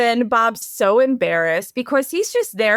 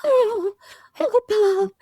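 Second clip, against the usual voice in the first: very high, plaintive little whimper; whiny puppy; 10 semitones higher, slower than usual. Retold or imitated. Imitating the film character calling Bob, in a puppyish whine. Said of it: "She's like a whiny puppy".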